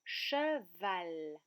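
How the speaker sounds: "cheval" slowly split as che, val; syllable by syllable. Shown as a demonstration. Teacher asks children to count syllables, word split slowly. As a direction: say "cheval" in two separate beats. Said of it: "Combien y a-t-il de syllabes".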